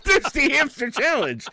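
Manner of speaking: very high, loud squeal through laughter; squealed girl quote. Retold or imitated. The girl in the car squeals the challenge name. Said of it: "and then she goes".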